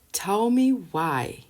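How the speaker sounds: "Tell me why" dragged out, high on "me"; exasperated, drawn-out plea of "Tell me why"; incredulous demand. Real, not acted. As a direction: stretch "Tell me why" slowly.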